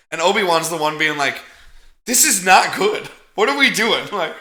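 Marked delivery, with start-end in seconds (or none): 2.0-4.1 raised, louder voice of mild panic; mock alarm. Obi-Wan worrying while people attack the ship, mock alarm. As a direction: lightly play up the alarm.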